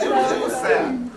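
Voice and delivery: normal talking voice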